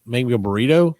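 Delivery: ordinary talk, nothing marked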